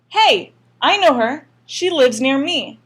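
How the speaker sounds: loud, high accusing yell. A narrator's reading. Stranger in the mob yelling to point out the girl. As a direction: point her out to the crowd. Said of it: "someone on the street yelled".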